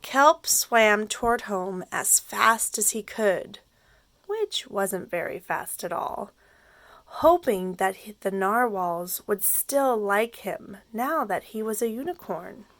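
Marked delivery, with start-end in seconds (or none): none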